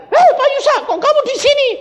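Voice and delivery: high, loud delighted hello; startled cry of recognition; surprised greeting. Retold or imitated. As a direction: greet an old friend you never expected to meet. Acquaintance greets him in surprise.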